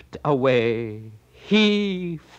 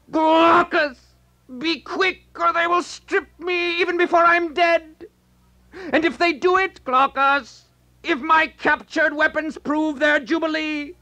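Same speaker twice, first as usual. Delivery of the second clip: much higher, urgent voice of a fallen hero; dying warrior's plea; 10 semitones higher than usual. Acted. Fallen Sarpedon begs Glaucus to defend his corpse, high and urgent. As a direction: beg your friend to guard your body. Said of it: "and calling".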